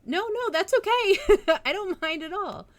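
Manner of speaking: high, loud giggly no-no; squealy happy reassurance; high laughing reassurance. Real, not acted. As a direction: laugh it off brightly.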